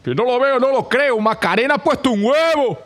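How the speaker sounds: high astonished exclamation; amazed farmer. Acted. Farmer crying out in amazement at the egg. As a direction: gasp it out in disbelief. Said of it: "gritó el granjero".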